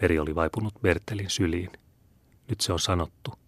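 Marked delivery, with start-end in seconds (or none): 2.5-3.3 very soft, dying-away voice; fading whisper. A narrator's reading. Meri confesses while sinking into Bertel's arms, fading whisper. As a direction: let the words fade, almost whispered. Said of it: "kuiskasi hän sammuvalla äänellä".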